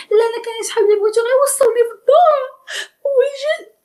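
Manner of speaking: mock whining girl. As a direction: whine like a girl pretending to cry.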